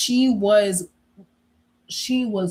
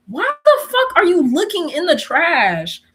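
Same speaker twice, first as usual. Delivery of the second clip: louder, high-pitched indignant demand; exasperated outburst; 7 semitones higher, 6 dB louder than usual. Retold or imitated. Teen confronting mom who went through the trash, exasperated. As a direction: blurt it out in exasperated disbelief.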